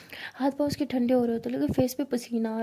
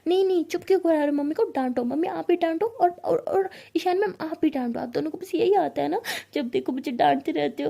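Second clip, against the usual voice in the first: higher, louder, fast tearful whine; crying complaint; 4 semitones higher, 3 dB louder than usual. Retold or imitated. Pari complaining tearfully to mother and Ishani, whiny and fast. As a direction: wail that everyone always scolds you. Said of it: "तो ऐसे कह के रो रही होती है".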